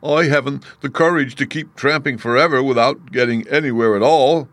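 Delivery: whimpering. A narrator's reading. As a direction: complain with a touch of a whimper. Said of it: "with a whimper".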